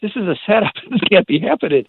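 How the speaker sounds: incredulous, amused protest; laughing disbelief. Retold or imitated. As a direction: react like it's a setup, half laughing. Driver realizes he is being scammed.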